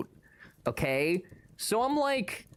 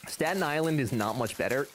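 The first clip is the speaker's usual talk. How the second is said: usual speaking voice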